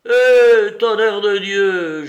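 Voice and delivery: juron hurlé, aigu, le tonnerre traîné lentement; juron furieux. Acted. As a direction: explose de rage, fort et haut. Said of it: "jura le père Soupe hors de lui". (Soupe hors de lui jure contre Larrier, hurlant)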